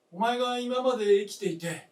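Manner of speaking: impassioned cry. Acted. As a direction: let the wish burst out. Old man's impassioned wish.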